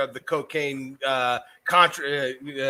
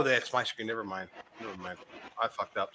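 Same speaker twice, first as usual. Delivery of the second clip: quiet embarrassed mumble; trailing off, mumbling; muttered; 8 semitones lower, 13 dB quieter than usual. Real, not acted.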